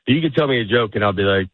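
ordinary talk, nothing marked

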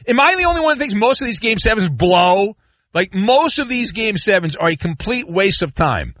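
ordinary talk

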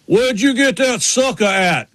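high, slow imitation of a Southern driver; Ward Burton. Driver butting into a live interview, high slow impression. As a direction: stretch it out in a high mock-driver voice. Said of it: "Is Ward Burton. He goes".

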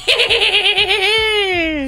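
high, far cackling witch laugh; evil witch laugh. Pretend. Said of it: "now you go".

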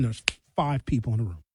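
usual speaking voice

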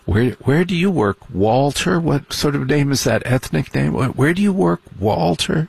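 high, sniffy preppy voice; snooty preppy. Retold or imitated. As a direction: look down your nose at him. Snooty preppy questioning him.

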